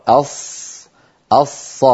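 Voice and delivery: أص with a heavy emphatic ṣād and no lip rounding; correct Arabic ṣād. Shown as a demonstration. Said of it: "كما نرى".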